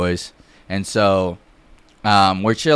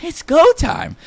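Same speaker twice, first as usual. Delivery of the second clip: very high, excited call; hyped-up battle cry; slower than usual. Retold or imitated. Storyteller and buddy joining the fight, hyped. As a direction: squeal it, eager for the fight.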